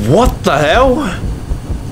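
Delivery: slow, high disbelief; disbelieving. Real, not acted. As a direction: drag it out in shock. Shocked after escape.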